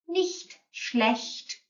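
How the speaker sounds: "nicht schlecht" with a throaty ch; careful pronunciation. Shown as a demonstration. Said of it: "quite difficult to get your mouth around".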